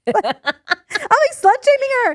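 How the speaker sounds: squealing through laughter; laughing high. Real, not acted. Telling her friend the story, laughing up high. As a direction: crack up high while confessing.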